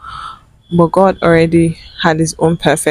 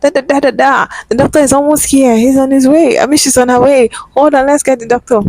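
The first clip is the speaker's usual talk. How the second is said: flustered nurse; 7 semitones higher, faster than usual. Retold or imitated. Flustered staff rushing, retold.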